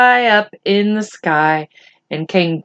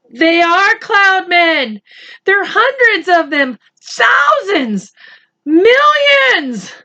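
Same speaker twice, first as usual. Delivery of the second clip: climbing higher with each word; panicked; 10 semitones higher, slower than usual. Acted. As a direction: build from alarm to panic. Panicked character sees cloudmen.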